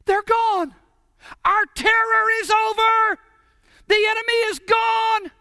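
high-pitched, amazed announcement; acted relief breaking into exultation; sudden joyful realization. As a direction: call it out high, as news just dawning. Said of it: "all of a sudden they realized".

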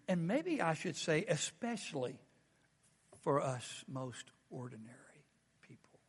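whispered humble aside. Real, not acted. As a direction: lean in and confide it.

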